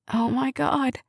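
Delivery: a mild breathless whimper of dismay; understated tearful whimper. A narrator's reading. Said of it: "Karen whimpered".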